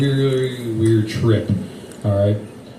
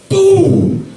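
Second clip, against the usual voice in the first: sudden high shout; loud boom; 5 dB louder than usual. Retold or imitated. Comic acting out slamming the dice cup, loud. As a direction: slam it out loud.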